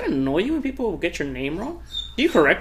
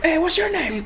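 high-pitched dubbed dog greeting; high, comic pet voice; talking-for-a-dog voice; 11 semitones higher than usual. Acted. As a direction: voice a dog saying hello, way up high. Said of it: "people talk for their dogs".

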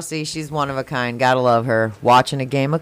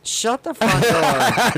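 slow, drawn-out laughing disbelief; stunned disbelief; 6 semitones higher, much slower than usual. Real, not acted. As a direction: stretch it out, half laughing. Stunned reaction to ghost theory.

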